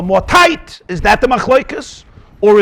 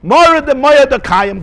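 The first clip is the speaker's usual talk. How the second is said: ordinary delivery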